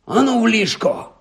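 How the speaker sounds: high-pitched angry order; furious hiss. A narrator's reading. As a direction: order them off, hissing with fury. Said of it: "люто засичав Рон".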